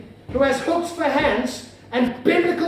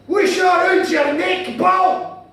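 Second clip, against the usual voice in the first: slow, loud Glaswegian shout; guttural Glaswegian; 8 semitones higher, 7 dB louder, much slower than usual. Acted. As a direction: holler it high and drawn out in thick Glaswegian. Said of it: "then he said to me".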